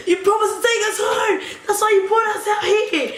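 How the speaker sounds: high, whiny mock shout; hammed-up accusing wail; mock wailing demand. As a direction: imitate girls whining at their driver. Acted girls' angry demand.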